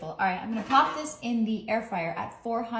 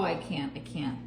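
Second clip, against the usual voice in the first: usual speaking voice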